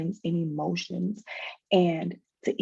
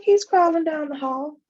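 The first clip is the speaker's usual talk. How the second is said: put-on excited child; 11 semitones higher, 5 dB louder than usual. Daughter voiced in retold story.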